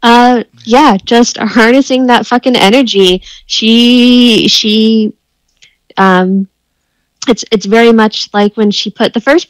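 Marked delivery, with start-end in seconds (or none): none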